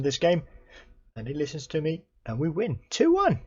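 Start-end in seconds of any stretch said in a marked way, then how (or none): 2.9-3.4 much higher, loud triumphant call; elated cheer. Real, not acted. The final whistle blows on the win, elated cheer. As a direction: celebrate the win as the whistle goes.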